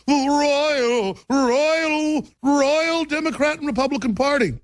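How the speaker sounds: very high, grandiose mock proclamation; pompous royal voice. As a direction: announce it like a herald, sneering. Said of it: "He said".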